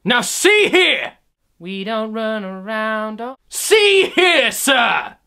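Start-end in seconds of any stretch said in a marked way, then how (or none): 0.1-1.1 high, loud outraged bark on SEE; a stuffy royal losing his temper; pompous shout. Acted. 3.5-5.2 much higher, loud outraged yell; pompous shout. Acted. Stuffy royal scolding.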